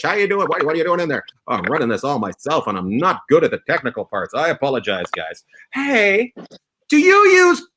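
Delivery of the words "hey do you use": high, loud salesman voice; mock pitchman. Host doing a cheesy pitchman voice for a fake coupon ad. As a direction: sell it like a cheesy ad.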